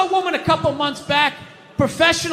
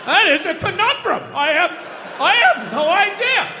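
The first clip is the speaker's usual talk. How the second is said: dumbfounded stammer. Retold or imitated. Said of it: "They had to sit there, like, dumbfounded".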